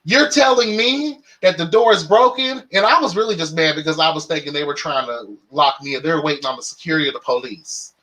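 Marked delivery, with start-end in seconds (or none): 0.0-2.7 indignant. Retold or imitated. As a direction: throw it at her in disbelief. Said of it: "this happened last week".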